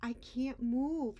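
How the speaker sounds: little clam afraid and stuck; scared plea. Acted. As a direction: beg the current, stuck and scared. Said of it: "he told the current".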